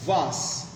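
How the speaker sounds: "vas" with a long open ah; British pronunciation. Shown as a demonstration. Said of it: "In British English, it is pronounced as". ("vas" का British उच्चारण, लंबे "आ" के साथ)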